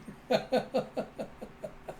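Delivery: high breathy giggle fading out; helpless giggle at a funny picture; laughing too much to go on; real giggle. Real, not acted. Narrator amused by the scene.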